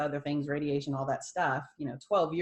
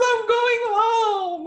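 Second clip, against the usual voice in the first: slow, tearful and much higher; mother weeping happily that she is going home; crying with joy; 10 dB louder, much slower than usual. Retold or imitated. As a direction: sob it out, overjoyed. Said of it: "she just starts bawling".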